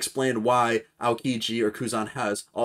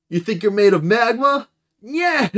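much higher, louder taunting voice; mocking voice; 10 semitones higher, 8 dB louder than usual. Blackbeard taunting Aokiji about magma, much higher and louder. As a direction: tease the rival with a sneer. Voicing manga characters.

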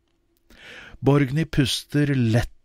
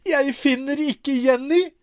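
high panicked wail; a mother crying out for her lost child; 11 semitones higher than usual. Acted. Fru Øye cries out for her missing daughter, panicked. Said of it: "fru Øye som hyler".

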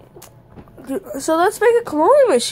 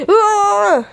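high, loud mock scream; demonstrated scream; 7 semitones higher, 5 dB louder than usual. Shown as a demonstration. [name] shows how they would react to an attack, mock scream. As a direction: let out a short pretend scream. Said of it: "we'd be like".

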